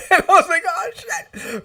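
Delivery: high-pitched, half-laughed retold curse; squeaky-high amused panic; laughing dismay. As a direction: laugh through a comic moment of dread.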